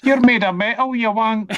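high, loud laughing Glasgow taunt; Glasgow street accent. Retold or imitated. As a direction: yell it like a cheeky Glasgow kid. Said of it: "ridiculous Scottish accents".

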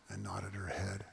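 hushed whisper at bedside; barely voiced, slow hush. A narrator's reading. Bedside scene, voice drops.

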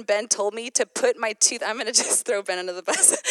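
usual speaking voice

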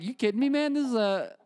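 high-pitched incredulous retort; mock-astonished, pitched well up; acted disbelief. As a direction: react like you can't believe him, voice jumping up. Acted disbelief at manager's emotion.

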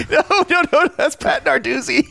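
high-pitched, through real laughter; laughing objection. Real, not acted. Correcting a friend's joke casting of football coaches, laughing hard. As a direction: protest while cracking up.

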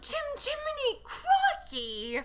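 very high, silly British squeal; hammed-up British exclamation, ending in amusement. Imitating how they all talked, hammed-up British accent. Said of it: "the most offensive British accent".

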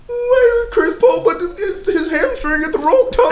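mocking fan voice. Mocking a co-host's excuses in a high laughing voice. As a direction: squeal it through laughter like a fan making excuses. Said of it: "That is how I sound".

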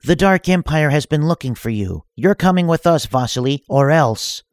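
threatening villainess. Acted. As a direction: threaten him with a sly edge. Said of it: "threatening arrest".

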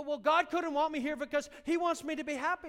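imitating a whining excuse; mocking whiner. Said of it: "You go".

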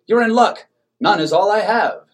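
high, loud gleeful announcement; enthusiastic. A narrator's reading. Manager gleefully offering nothing but bread. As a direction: sell it like great news.

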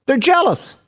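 high, heated sermon storytelling; impassioned, high-pitched preaching; full-force preaching. Acted. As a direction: build to an outraged preacher's shout.

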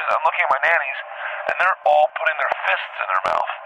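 ordinary delivery

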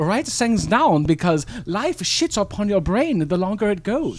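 lightly put-on German accent; old German philosopher voice. Improv German character Ehrlich.

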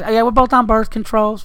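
high, loud, matter-of-fact Southern voice; pushy Southern girl. Retold or imitated. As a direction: quote her again deadpan. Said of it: "It's just".

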